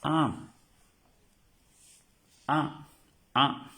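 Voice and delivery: nasal vowel drill. Shown as a demonstration. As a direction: sound out A, A, A for the class. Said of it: "también la pronunciamos".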